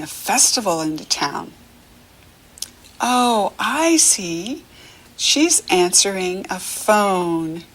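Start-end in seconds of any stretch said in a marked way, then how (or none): none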